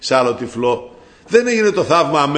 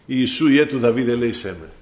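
ordinary talk, nothing marked

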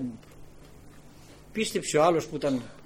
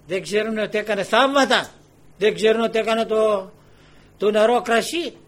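louder, insistent rhetorical questions; impassioned preaching; 5 semitones higher, 8 dB louder than usual. Real, not acted. Preacher challenges listeners over Christ's miracles, impassioned. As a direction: press each question on the congregation.

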